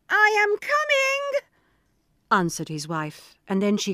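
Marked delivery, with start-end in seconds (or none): 0.1-1.4 very high, slow begging; trembling plea. Acted. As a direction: stall him timidly. Wife answers fearfully.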